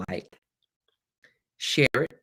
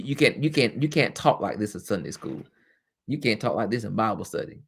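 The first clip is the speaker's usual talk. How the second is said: ordinary talk, nothing marked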